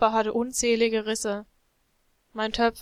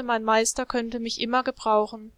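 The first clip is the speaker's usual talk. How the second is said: ordinary delivery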